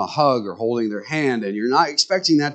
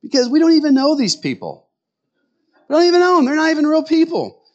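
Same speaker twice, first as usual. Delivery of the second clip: mocking husband; 14 semitones higher than usual. Husband scoffing at his wife crying over a movie, high mock whine. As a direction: whine it like a baffled husband who doesn't get the tears. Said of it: "men will sit there and go".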